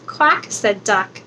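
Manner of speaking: ordinary delivery, nothing marked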